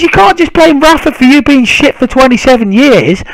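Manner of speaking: high-pitched, worked-up comeback; retold indignant retort. Retelling his comeback to a rival fan, indignant. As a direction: re-enact your exasperated side of the row.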